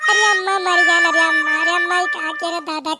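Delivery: loud comic howl of pain; a son bawling for his mother after a beating; hammed-up wailing. Son wails after being hit. (ज़ोर से नाटकीय चीख़-पुकार)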